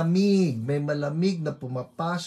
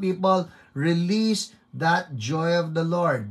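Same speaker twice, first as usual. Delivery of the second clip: ordinary talk, nothing marked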